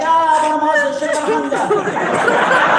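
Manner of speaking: loud, hammed-up fake tongues; mock speaking in tongues. Mom faking tongues in the car, hammed-up and loud. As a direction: mom pretends to pray in tongues, then snaps.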